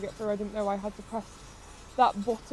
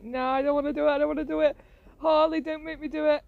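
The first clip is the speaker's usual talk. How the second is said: higher, louder, pleading not to go on; mock scared pleading; 6 semitones higher, 5 dB louder than usual. Player begging Harley not to make her go on, scared. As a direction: beg your friend not to make you. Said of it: "I was like".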